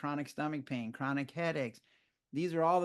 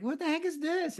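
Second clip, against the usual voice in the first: retold incredulous question, much higher; baffled outrage; 13 semitones higher than usual. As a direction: sputter it in disbelief. Asking accountant about audit.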